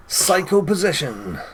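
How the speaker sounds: drawn-out announcer naming Psycho Possession; slow dramatic reading. Real, not acted. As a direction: stretch Psycho Possession out slowly. Reading move names slowly.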